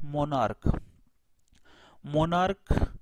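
मोनार्क ending in a hard "k"; careful pronunciation. Shown as a demonstration. Teacher showing students the word twice, मोनार्क with final "k". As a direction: say मोनार्क with CH sounded as "k". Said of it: "इसका pronunciation होता है".

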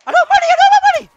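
very high, loud, rapid squeal; squeaky high-speed panic; panicked shriek. Real, not acted. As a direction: shriek it in a frantic rush. Panics at lacking money.

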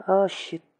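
ordinary talk, nothing marked

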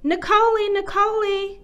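high, loud mother's call. A narrator's reading. Mother calls Nicole for bedtime, calling out. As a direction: call the name across the house.

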